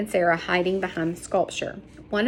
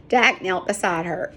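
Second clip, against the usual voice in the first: usual speaking voice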